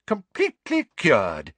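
high-pitched triumphant announcement; bright announcement. A narrator's reading. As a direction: proclaim the good news brightly. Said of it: "he announced brightly".

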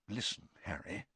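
very soft, confidential murmur; very low voice. A narrator's reading. Bagman takes Harry aside, in a very low voice. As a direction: draw him in very quietly. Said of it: "still in a very low voice".

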